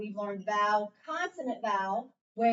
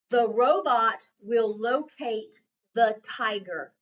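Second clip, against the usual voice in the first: slow dictation, "robot" stressed most, every word clear and separate; 8 dB louder, slower than usual. Shown as a demonstration. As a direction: dictate the sentence slowly to the class. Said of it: "Here's your sentence".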